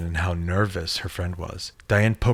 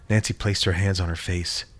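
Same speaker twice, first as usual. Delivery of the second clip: ordinary delivery, nothing marked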